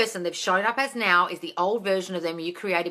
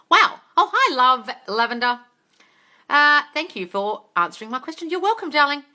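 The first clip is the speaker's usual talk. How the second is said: excited greeting; 7 semitones higher, 8 dB louder than usual. Real, not acted. Greeting live chat viewer.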